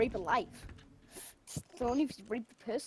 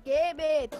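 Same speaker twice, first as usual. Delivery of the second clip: mock taunting voice; 5 semitones higher, 6 dB louder than usual. Mocking taunt in put-on voice.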